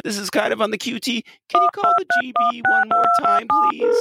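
high, fast, pushy producer voice; a flustered studio boss scrambling; frantic studio producer. Pretend.

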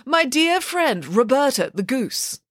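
slow, high-pitched lament; aching fondness for a friend; mournful. A narrator's reading. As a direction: draw it out with theatrical sorrow. Nanny laments her dear friend.

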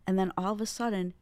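ordinary delivery

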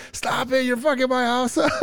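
high-pitched mock scolding yell; aunt yelling. Retelling an aunt stopping two cousins wrestling indoors, mock high yell. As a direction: screech it like a fed-up aunt, breaking into a laugh.

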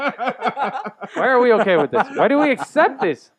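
high, laughing outrage; laughing rant. Real, not acted. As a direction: laugh through the complaint. Laughing rant about society.

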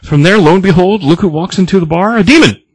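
high, loud, building up to the big reveal; excited reveal. Real, not acted. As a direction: announce the demon like a cliffhanger. Dramatic reveal of the demon.

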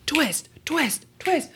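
high, punchy repeats climbing each time; playful rising repetition; mounting plot twists. Acted. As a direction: step the pitch up on each word. Acts out successive plot twists.

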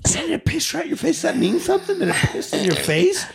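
high, teasing repeated questions; high mocking needle. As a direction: poke fun at her friend. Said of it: "Sophie's just sitting like".

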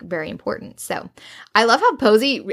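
ordinary talk

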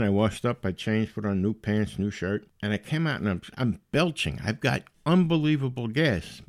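ordinary talk, nothing marked

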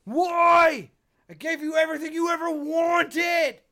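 imitated villain's anguished yell; high, very loud accusing outburst; Reverse Flash.

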